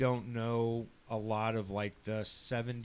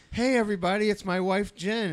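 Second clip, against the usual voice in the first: high, loud cheery intro; excited announcement; 9 semitones higher, 6 dB louder than usual. Retold or imitated. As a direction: announce her proudly. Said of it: "I'm like".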